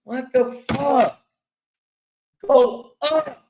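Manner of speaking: high, loud yell to go up; exasperated coach yell. Acted. Coach yelling at the player to shoot, high loud exasperation. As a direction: shout like a fed-up coach. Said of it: "coach yelling at player".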